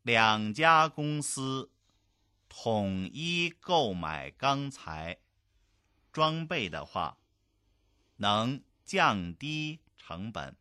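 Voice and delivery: very slow, phrase by phrase; slow model reading. Shown as a demonstration. Language teacher reading a business dialogue for learners, slow and segmented. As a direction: stretch each word out, pausing between phrases.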